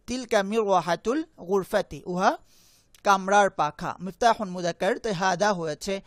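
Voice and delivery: usual speaking voice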